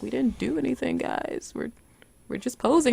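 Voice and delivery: soft, half-voiced aw-shucks reply; mock bashful hush; hushed modest protest. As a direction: play it down almost under your breath. Modest reply to cheering crowd.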